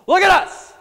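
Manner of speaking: sudden loud, high shout. Retold or imitated. Preacher acting out Peter shouting at the lame beggar. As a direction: yell it right at the beggar. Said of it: "So he, like, shouting at this guy".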